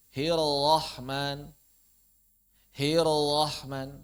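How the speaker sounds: slow "Hirrahman" with a slack, untrilled double R; tongue released early. Shown as a demonstration. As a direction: loosen the R in "Hirrahman" before landing it. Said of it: "Melepas lidah sebelum mengucapkannya". ("Hirrahman" keliru, lidah dilepas dulu)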